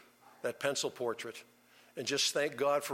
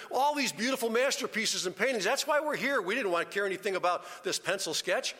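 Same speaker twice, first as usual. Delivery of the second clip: much higher, loud furious hollering; angry crowd yelling; 8 semitones higher, 4 dB louder than usual. Retold or imitated. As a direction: scream it at the auctioneer in outrage. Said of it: "They're screaming".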